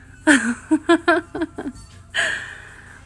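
high playful giggle; flirty giggle. Acted. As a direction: giggle teasingly. Flirty laugh between lines.

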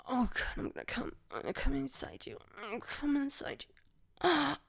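fast, rising, breathless gasps; breathless climax. Acted. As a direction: rush it out breathlessly.

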